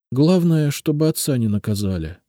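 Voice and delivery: usual speaking voice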